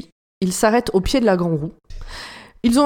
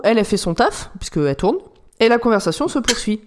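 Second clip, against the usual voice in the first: ordinary talk, nothing marked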